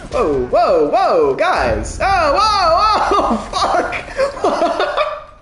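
loud whoas leaping high, breaking into laughter; startled high yelling that tips into laughing; real startled alarm. Real, not acted. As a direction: yelp in escalating panic, then laugh it off. Caught by surprise during gameplay.

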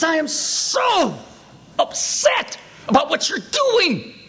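high, drawn-out indignant mimicry; spiteful officer. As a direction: stretch it out in mock outrage. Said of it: "who was just particularly spiteful".

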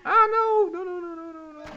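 high, loud wail of no, fading away; dismayed cry. Real, not acted. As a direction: cry out in despair as it goes wrong, then trail off. His unit gets killed.

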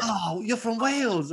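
high, drawn-out imitation of sudden realisation; mock realisation. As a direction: act out the penny dropping, slow and high. Stranger finally realises where he's from.

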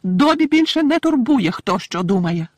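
high, loud, slow declaration; squeaky and emphatic, dropping at the end; proudly defiant little elf. A narrator's reading. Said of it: "виклично сказав Добі".

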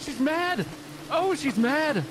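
very high, squealing alarm; panicked. Real, not acted. Warning teammates the angry demon is downstairs, high panic.

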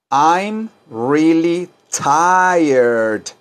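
very slow, drawn-out teacher model; slow model for repeating. Shown as a demonstration. Teacher to students, drawing out each word slowly.

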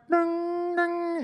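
high sung ding ding of a car door alarm; car door chime noise; imitated door chime. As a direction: mimic the open-door chime.

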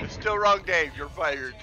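shouted fake firing, high and loud; loud mock boss. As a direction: yell it like a cartoon boss. Repeating the joke firing louder.

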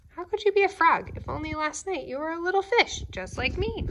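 high, quick scoffing little fish; 小さな魚が高く速くあざける声; scoffing minnow. A narrator's reading. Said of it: "said the minnow".

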